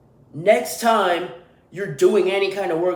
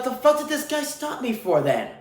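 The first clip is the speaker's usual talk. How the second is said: pitched way up in disbelief, exasperated; what was that for, raised high; incredulous irritation; 8 semitones higher than usual. Retold or imitated. Driver baffled at the road worker, high exasperated pitch.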